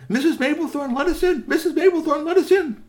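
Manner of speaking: high, loud pleading call. Acted. Boys at the back door call to Mrs Maplethorne, high and loud. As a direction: call through the door to be let in.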